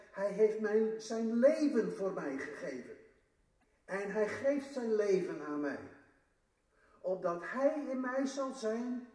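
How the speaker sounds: luider en vuriger preken; krachtig preken. Acted. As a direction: verkondig het met kracht aan de gemeente. Preacher rises to full force. (luider en vurig preken)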